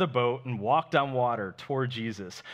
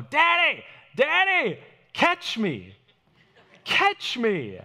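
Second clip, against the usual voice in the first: put-on high, loud little kid calling; Jojo; 11 semitones higher, 6 dB louder, slower than usual. Small son to his dad from a bunk bed, excited calling. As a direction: shout like an eager child wanting attention. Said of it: "Jojo likes to go on the kitchen countertops".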